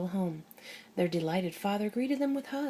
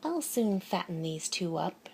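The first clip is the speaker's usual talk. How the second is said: ordinary delivery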